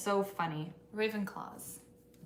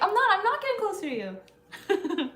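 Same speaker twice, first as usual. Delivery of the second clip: flustered protest; 11 semitones higher, 6 dB louder, faster than usual. Acted. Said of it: "And you're like".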